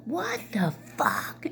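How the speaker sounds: stretched-out mock yell, held back in volume; imitated yell. Mother reacting to the rebuff, mock yelling. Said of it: "She yelled".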